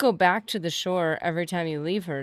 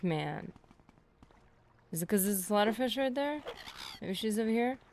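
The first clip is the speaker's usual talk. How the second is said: ordinary talk, nothing marked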